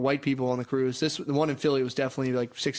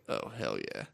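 deep delight; 11 dB quieter than usual. Retold or imitated. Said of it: "and I was like".

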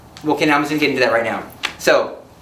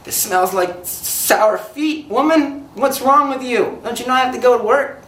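higher, slowed-down exasperated griping; mock angry complaint; 7 semitones higher, slower than usual. Husband to wife over laundry left three days, mock angry whine.

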